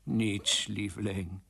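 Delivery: soft, low, downcast reply; saddened. Acted. King admits defeat to his wife, soft and sad. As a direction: answer meekly, voice sinking away. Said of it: "keek haar bedroefd aan".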